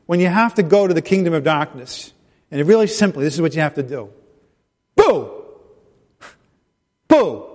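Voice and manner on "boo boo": startling boo. Pretend. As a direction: shout boo at them. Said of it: "Did I scare you?".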